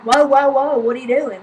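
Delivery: loud mock objection, easing off at the end; retold loud challenge. Woman stops man scooting past her, loud retold challenge. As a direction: imitate someone halting a line-cutter.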